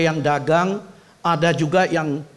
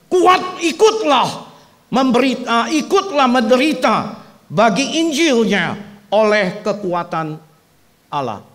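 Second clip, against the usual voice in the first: proclaimed scripture; 6 semitones higher, 6 dB louder than usual. Acted. Pastor reading Timothy to the congregation, loud solemn proclamation. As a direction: declaim each word of the verse.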